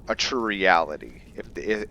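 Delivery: ordinary talk, nothing marked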